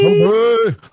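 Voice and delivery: high, slow, loud stretched-out shout; hump day call. Acted. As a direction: drag it out like a goofy ad. Co-host echoes the gag.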